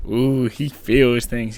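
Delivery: "Ooh, he feels things" cooed low and slowly in teasing; mock swooning. Viewer teasing the dreamy sailor, slow mocking coo.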